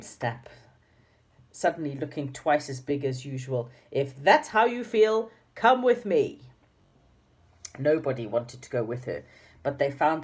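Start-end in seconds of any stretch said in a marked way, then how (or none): 3.9-6.4 menacing command. A narrator's reading. Dame Snap menacing the children.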